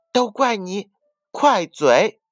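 a hint of a sulky child blaming; lightly voiced annoyance. Rabbit blames the myna bird, mildly cross. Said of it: "他生气地对快嘴八哥说".

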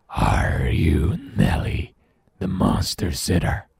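slow, rasping monster whisper; menacing creature at the door; deep, scary voice. Acted. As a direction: rasp it out slowly like a lurking monster. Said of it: "asks the deep, scary voice".

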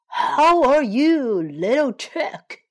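drawn-out greeting from a storybook fox; fox character. A narrator's reading. Fox greets his prey.

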